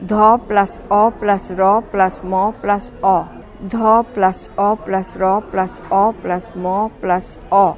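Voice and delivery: spelled out slowly. Shown as a demonstration. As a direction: sound by sound, each joined with plus. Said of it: "ধর্ম থেকে ধরম". (ধ্বনি ধরে ধরে ধীরে বানান)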